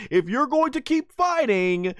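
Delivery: high-pitched mock scolding; hammed-up high warning; imitated ultimatum. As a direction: threaten like a fed-up cartoon wife. Acts out Bulma's ultimatum.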